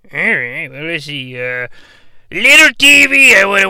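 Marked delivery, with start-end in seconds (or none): 2.3-3.7 higher, louder call to the TV. Acted. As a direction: hail the little TV. Calling out to the device.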